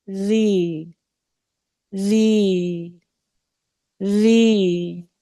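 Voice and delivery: ज़ी drawn out slowly as a long ee; long zee. Shown as a demonstration. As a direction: stretch ज़ी into a long vowel. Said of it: "उससे पहले ज़ेर है".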